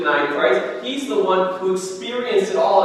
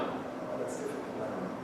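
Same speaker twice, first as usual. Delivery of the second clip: whispered excuse; 9 semitones lower, 17 dB quieter than usual. Pretend. Acted excuse to God's call.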